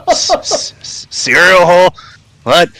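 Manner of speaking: high-pitched bandit, thrown off; puzzled query in a high character voice; baffled toll-keeper. Acted. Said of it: "you hear a voice say".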